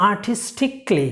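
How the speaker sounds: আর্টিস্টিকলি said slowly as ar-tis-tik-lee; correct pronunciation. Shown as a demonstration. Teacher giving learners the right form, আর্টিস্টিকলি ending "tik-lee". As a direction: leave out the A in আর্টিস্টিকলি. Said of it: "সঠিক উচ্চারণটা কি?".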